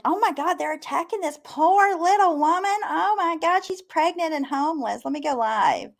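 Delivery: high, whiny mock-dramatic voice; mocking quote. Mocking a streamer's overblown alarm, high and whiny. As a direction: pitch it up and mock her alarm. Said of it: "and she's like".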